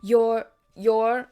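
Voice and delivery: vague stammer. A narrator's reading. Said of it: "he said vaguely".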